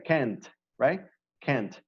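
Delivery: "can't" with a hard T at the end; final T stressed. Shown as a demonstration. Teacher showing students the ending T. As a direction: land on the T of "can't". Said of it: "it's a letter T".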